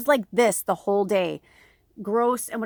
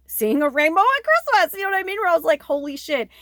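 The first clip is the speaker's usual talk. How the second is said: awed excitement; 8 semitones higher, 7 dB louder than usual. Retold or imitated. Awe retelling rainbow on Christmas.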